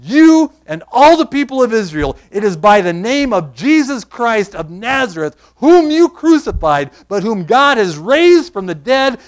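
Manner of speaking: loud, slow preaching with drawn-out key words; forceful scripture declamation. Acted. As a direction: proclaim the verse with ringing emphasis.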